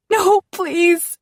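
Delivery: high, loud terrified plea; desperate begging. Acted. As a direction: cry out for mercy. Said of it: "All I can do is beg".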